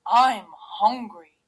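loud, slow big-bear voice; Daddy Bear. A narrator's reading. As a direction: announce it heavily as the father bear. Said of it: "said Daddy Bear".

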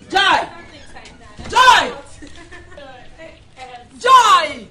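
loud, high shouted summons; screaming. Acted. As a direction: yell for someone in another room. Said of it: "why are you screaming".

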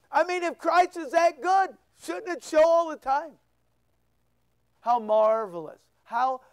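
ordinary talk, nothing marked

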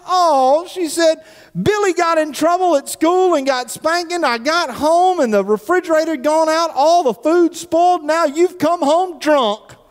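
high whiny tearful wife; wailing wife. Retold or imitated. Wife wailing her bad day to her husband, high and whiny. Wife's punchline in joke.

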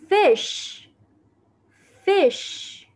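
put-on teacher repeats "Fish." for children to copy; model English word. Shown as a demonstration. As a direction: model "Fish." high and clear for the class to repeat. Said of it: "Con cá đọc là fish".

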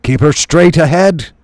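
loud, high called order; shouting. Acted. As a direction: shout it across the deck. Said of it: "shouted Log-a-Log".

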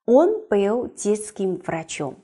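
Он был детским врачом, each word laid out slowly; slow model sentence. Shown as a demonstration. Teacher reads the example slowly for the class. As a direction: read slowly and evenly as a model. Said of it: "大家眼睛找一下".

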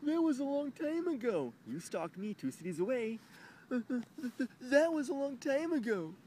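high, loud mocking voice; hammed-up girlfriend, scoffing; mock her brushing it off; mocking ex dismissing. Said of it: "I confronted her".

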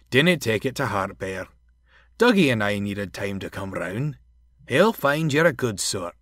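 friendly Scots engine voice; Donald, reassuring engine. Acted. Donald consoling hurt Bear, Scots and reassuring.

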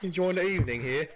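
a genteel British stroller; mock British accent. Mock British accent to friends.

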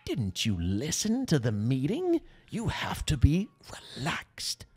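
slow, odd-accented impression, fading to a whisper; Brian. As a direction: put on an unplaceable accent, stretching it out. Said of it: "He had an interesting accent".

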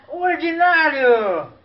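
slow, loud and high "o ordinário!"; proud, drawn-out "ordinário"; drawn-out exclamation. Acted. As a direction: stretch out "ordinário" like a punchline. Joking mispronounced vet word.

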